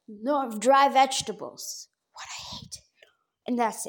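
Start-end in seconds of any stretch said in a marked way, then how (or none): 2.1-2.8 whisper. Real, not acted. Whispered aside.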